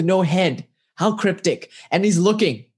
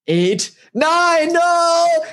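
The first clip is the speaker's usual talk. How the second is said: high, louder cry of panic; hammed-up wail as the count runs out; mock scream; 12 semitones higher, 4 dB louder, much slower than usual. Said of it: "they start to scream and cry".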